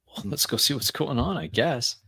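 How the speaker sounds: ordinary talk, nothing marked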